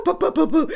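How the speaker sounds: high, loud bouncing syllables; rapid comic sound effect; imitated tumbling noise. A narrator's reading. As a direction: rattle off popping noises up high. Imitates bird popped off head.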